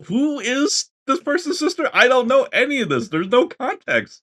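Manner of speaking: high-pitched mock bewilderment; baffled player. Player reacting to unknown characters, high baffled complaint. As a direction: protest way up high, acting lost.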